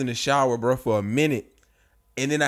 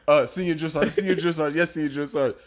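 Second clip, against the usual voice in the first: flustered recruits; 4 semitones higher than usual. Retold or imitated. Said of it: "And everybody's like".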